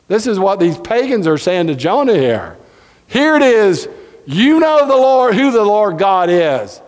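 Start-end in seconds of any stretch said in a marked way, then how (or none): none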